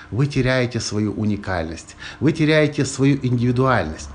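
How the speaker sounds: ordinary talk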